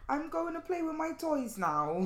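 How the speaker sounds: put-on high, slow sing-song Welsh child; Welsh accent. Imitating River announcing playtime, Welsh accent. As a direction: mimic a little Welsh kid, stretched out. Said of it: "I love a Welsh accent".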